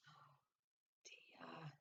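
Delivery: hushed worried whisper; whispering to the listening children. A narrator's reading. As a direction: breathe it almost silently. Teller whispers aside.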